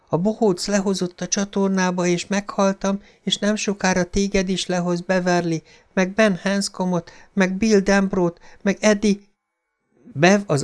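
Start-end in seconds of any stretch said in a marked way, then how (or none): 0.1-9.2 put-on high, hushed ghostly child; a dead boy calling up from the drain; eerie drain voice. A narrator's reading. Dead boy's voice from the sink warns Beverly, high and eerie.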